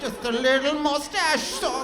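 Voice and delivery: high put-on character voice; high, slow mock voice. Hitler bit, voiced line.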